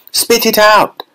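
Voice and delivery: high, urgent order; urgent command. A narrator's reading. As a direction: make her spit it out. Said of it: "said Mister Wonka".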